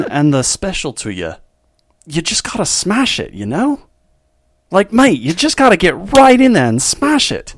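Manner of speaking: hammed-up Australian mate giving love advice; Australian accent. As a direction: play a smitten Australian, emphatic and swooping. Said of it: "Australians with that exact accent".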